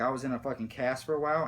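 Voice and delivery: usual speaking voice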